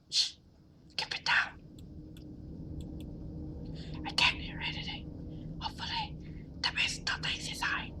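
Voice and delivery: hushed unvoiced whisper at the door. To Anita outside the parents' door, whispering. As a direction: whisper so no one inside hears.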